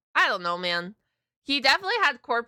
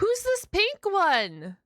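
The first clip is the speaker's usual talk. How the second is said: curious delight; 7 semitones higher, much slower than usual. Real, not acted. Spots pink character.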